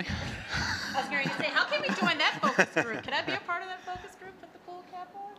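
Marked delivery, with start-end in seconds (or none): 0.0-4.2 loud laughing ask. Real, not acted. Guest asking to join the focus group, laughing loud. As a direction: laugh through the question, joking.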